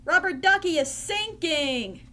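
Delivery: high-pitched dismayed exclamation; alarmed cry. Acted. Ernie tells Bert his toy is in trouble, crying out in alarm. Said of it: "cried Ernie".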